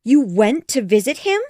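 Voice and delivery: can't believe your ears; astonished. Acted. Mrs Bennet astonished.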